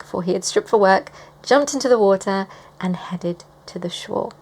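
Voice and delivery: normal talking voice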